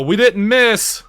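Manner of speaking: high, drawn-out yell; triumphant mock yell. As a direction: yell it across the battlefield to an ally, half full volume. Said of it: "we're both gonna yell".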